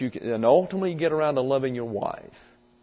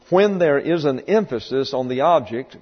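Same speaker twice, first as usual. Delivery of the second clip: ordinary talk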